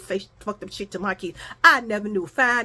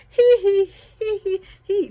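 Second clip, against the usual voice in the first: higher, louder mock giggle; mimicked giggle; 9 semitones higher, 5 dB louder than usual. Imitating the woman laughing at her phone, mocking giggle. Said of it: "laughing at her phone and shit".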